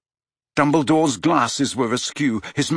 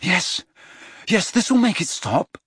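lying; 7 semitones higher than usual. A narrator's reading. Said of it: "lied Harry".

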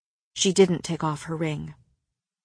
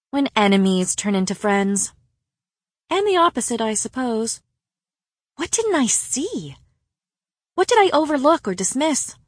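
higher-pitched, searching questions; lightly voiced woman, pitch swooping up as she questions herself; troubled confiding friend; 4 semitones higher than usual. A narrator's reading. Friend reflecting on enemies turned friends, troubled and searching.

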